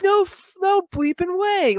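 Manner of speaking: very high, incredulous squeak; hammed-up high-pitched amazement; amazed disbelief. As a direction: replay stunned disbelief, way up high. Retelling his disbelief at the reveal.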